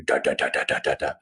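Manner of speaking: low chopping rotor noise made by mouth; imitated distant helicopter. Climber hears helicopter across the big valley, low imitated rotor. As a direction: mimic faraway rotor blades, low and rhythmic. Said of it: "start to hear the helicopter".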